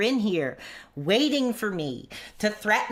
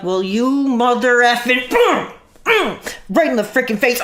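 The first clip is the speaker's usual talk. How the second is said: mock rage; 3 semitones higher, 8 dB louder than usual. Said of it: "And I'd be like".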